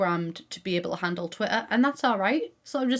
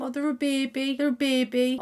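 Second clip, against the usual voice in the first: put-on cooing over a baby; 4 semitones higher than usual. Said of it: "'cause I think".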